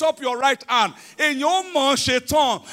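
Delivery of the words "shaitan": ordinary delivery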